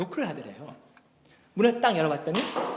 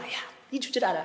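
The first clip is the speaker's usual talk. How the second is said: muttered scoff; 3 semitones higher, 4 dB quieter, faster than usual. Retold or imitated. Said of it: "목사님이 속으로 막".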